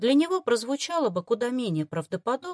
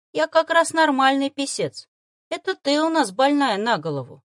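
higher, louder disgruntled creature grumble; a fox grousing at his mistress; grumbling familiar; 6 semitones higher, 5 dB louder than usual. Acted. Arctic fox grumbles at the girl, displeased. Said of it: "недовольно буркнул зверёк".

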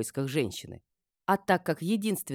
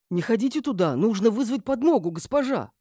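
raised pitch, loud alarmed warning; urgent warning; 8 semitones higher, 6 dB louder than usual. A narrator's reading. Guard begs mistress not to go in, alarmed. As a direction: warn her off in alarm.

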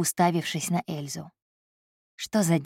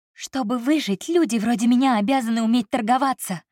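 hoarse exclamation; 6 semitones higher than usual. A narrator's reading. Secretary defending herself, hoarse and higher. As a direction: force it out through a tight throat. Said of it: "хрипло воскликнула она".

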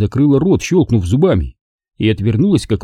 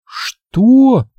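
high, louder sudden question; startled; 13 semitones higher, 3 dB louder than usual. A narrator's reading. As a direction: jolt at his words. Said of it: "спросила она".

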